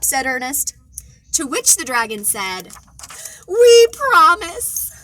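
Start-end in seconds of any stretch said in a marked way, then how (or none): 3.5-4.9 big promise. Acted. Dragon promises while giggling.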